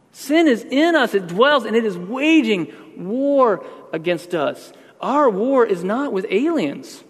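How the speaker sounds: high, slow fervent preaching; impassioned sermon peak; preaching at full force. Acted. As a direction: drive it home from the pulpit. Preaching climax.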